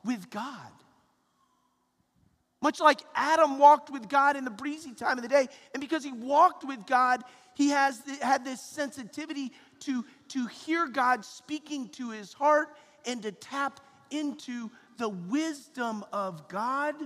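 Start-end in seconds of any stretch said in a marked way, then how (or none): 2.6-11.9 loud, fervent preaching. Acted. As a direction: preach with rising fervor. Preaching with rising force.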